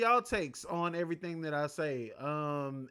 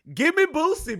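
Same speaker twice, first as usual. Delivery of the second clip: hyped call; 9 semitones higher, 8 dB louder than usual. Real, not acted. Hyped request, repeated.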